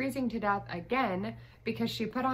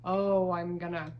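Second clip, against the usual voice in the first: ordinary talk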